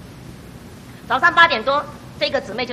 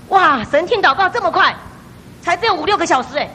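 amazed; 6 semitones higher, 5 dB louder than usual. Real, not acted. Marvels at answered prayer.

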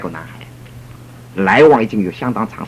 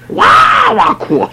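mock wailing; 4 dB louder, much slower than usual. Imitating couple wailing.

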